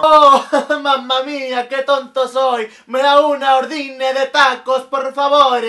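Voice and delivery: slow, mock Italian tacos order; hammed-up Italian customer; Italian accent. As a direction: put on a bad Italian accent. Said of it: "este es un restaurante italiano".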